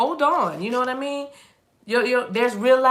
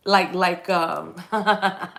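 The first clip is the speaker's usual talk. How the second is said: laughing; much slower than usual. Real, not acted. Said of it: "just heard Minnie Mouse".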